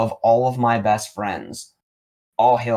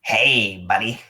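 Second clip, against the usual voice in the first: a mild raspy greeting on the phone; 3 dB louder, slower than usual. Retold or imitated. Caller on the phone greeting him, lightly raspy. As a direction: rasp out a friendly hey. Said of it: "this super raspy voice goes".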